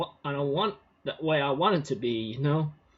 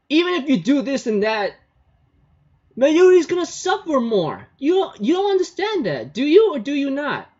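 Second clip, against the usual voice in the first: put-on much higher, louder girl's voice, scolding hard; Kurisu scolding; 11 semitones higher, 6 dB louder than usual. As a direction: voice Kurisu way up high, pleading and scolding. Kurisu after slapping Okabe.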